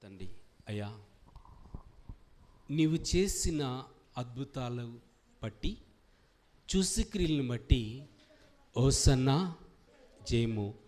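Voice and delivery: slow, measured prayer in short phrases; fervent prayer. Real, not acted. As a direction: begin low and hushed, swelling on each acclamation. Leads a closing prayer. (భక్తితో నెమ్మదిగా చేసే ప్రార్థన)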